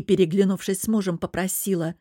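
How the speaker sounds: ordinary delivery, nothing marked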